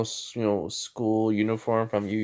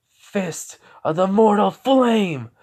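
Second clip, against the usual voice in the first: loud, slow battle cry of an attack name; shouted special move, drawn out; 9 semitones higher, 8 dB louder, slower than usual. Acted. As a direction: bellow the move name like an anime hero.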